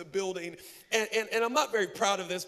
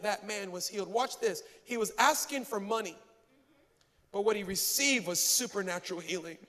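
ordinary delivery

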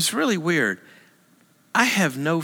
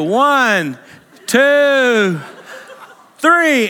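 building countdown; 8 semitones higher, 6 dB louder, much slower than usual. Retold or imitated. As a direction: count climbing higher and louder with each number. Instructors counting down the jump.

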